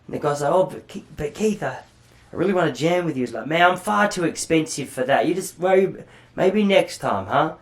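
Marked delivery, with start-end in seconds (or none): 0.5-3.2 higher-pitched, hesitant mock plea; stammering, hopeful retold plea; pleading interviewer. Interviewer pleading with rock star. 3.5-7.6 high-pitched British rocker brush-off; hammed-up British accent, fobbing someone off. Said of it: "Just in his voice that says".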